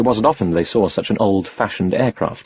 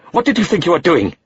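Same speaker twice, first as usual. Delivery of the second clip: exploding in anger; 9 semitones higher than usual. Acted. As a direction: blow up at the boy. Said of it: "Rahim exploded".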